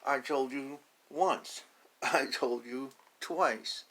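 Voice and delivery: slow, loud, firm scolding; loud slow warning with a laugh in it; scolding parent duck. Acted. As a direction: spell it out like a tired parent.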